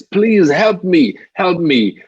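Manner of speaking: high, loud cry for help, slowed down; a trapped man calling up to strangers, pleading; desperate plea. Retold or imitated. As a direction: beg from the bottom of the pit. Man stuck in pit begs passersby.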